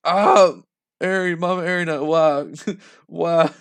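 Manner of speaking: high, loud mock wail; put-on cry voice breaking into a laugh; badly faked crying. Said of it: "I can't do a cry voice".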